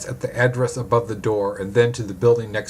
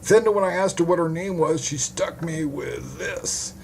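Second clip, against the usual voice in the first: higher-pitched hapless witness, recounting; duped male witness, aggrieved; 6 semitones higher than usual. Acted. Witness tells detective how she stuck him, high character voice.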